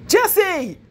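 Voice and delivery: high, loud call for her friend; frantic cry for a lost friend; desperate shout. Acted. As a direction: scream her name into silence. Friend stops answering mid-crash.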